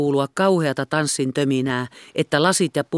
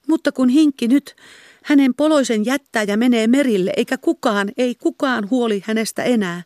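high, tearful lament; 8 semitones higher, 4 dB louder than usual. A narrator's reading. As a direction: wail about her fate, pitched much higher. Magda laments being abandoned.

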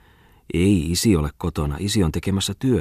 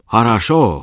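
loud, higher cheering cry; shouted cheer; 5 semitones higher, 5 dB louder, slower than usual. Acted. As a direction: bellow it in triumph. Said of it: "huusi maisteri Bungman". (innostunut huuto)

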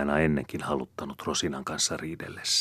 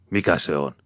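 loud, irritated question; angry demand; 7 dB louder than usual. A narrator's reading. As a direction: challenge her loudly. Angry man opens a quarrel.

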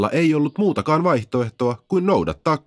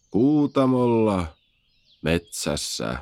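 sleepy stone; slower than usual. A narrator's reading. Taidan ottaa taas pienet nokoset.

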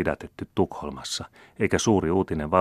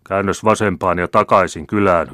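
loud, higher commanding call; a general hailing riders from his carriage; shouted order, higher and louder; eager shout; 4 semitones higher, 9 dB louder than usual. A narrator's reading. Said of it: "huusi kenraali innokkaammin".

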